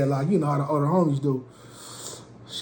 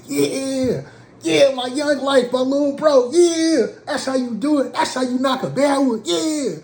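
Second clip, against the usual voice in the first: high, loud hyped-up cheering; hype friend cheer; 12 semitones higher, 4 dB louder than usual. Retold or imitated. Homies cheer as she takes his arm, high excited praise. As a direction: celebrate him like a proud big brother.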